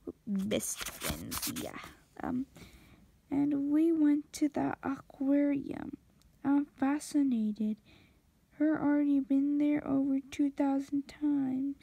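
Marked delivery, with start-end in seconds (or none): none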